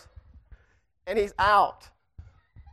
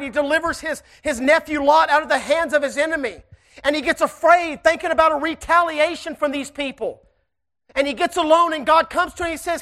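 louder, higher-pitched preaching to the congregation; 4 semitones higher, 4 dB louder than usual. Acted. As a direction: lift the church with a rising sermon. Preaches to the church.

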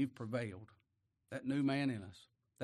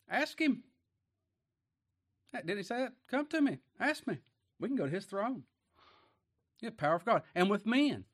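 high pleading; 6 semitones higher than usual. Acted. Preacher voicing God's invitation.

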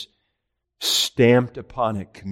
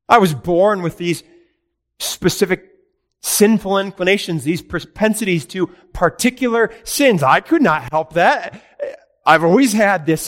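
higher and louder, a rising self-justifying complaint; mock sinner blaming God; 8 semitones higher, 6 dB louder than usual. Preacher voicing a sinner who blames God for his sins, rising higher and louder. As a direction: whine your excuses at God, climbing higher.

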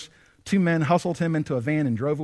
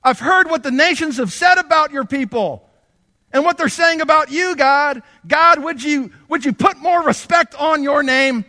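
high, loud cry to God, slow in places; desperate shouted prayer; 11 semitones higher, 8 dB louder than usual. Acted. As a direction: plead at the top of the voice. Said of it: "it's like Daniel saying".

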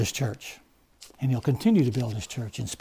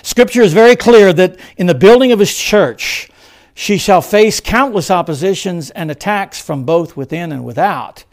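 loud, raised sermon proclamation; forceful preaching; 5 semitones higher, 10 dB louder than usual. Acted. Preacher warns the church of countless oppositions, forceful. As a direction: ring it out to the congregation.

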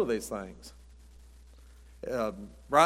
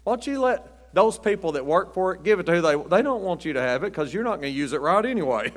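mock rant; 5 semitones higher, 4 dB louder than usual. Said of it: "I think".

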